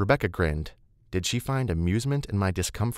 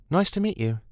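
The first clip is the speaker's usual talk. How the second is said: higher, light polite greeting; friendly young woman; 11 semitones higher than usual. Acted. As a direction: greet him sweetly. Rebecca's greeting.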